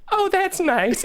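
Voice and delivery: high, sweet-little-old-lady imitation; politely unimpressed old mom, pitched high; elderly mother. Old mother reacting to an impression, high mild politeness. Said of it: "his, like, ninety-year-old mother".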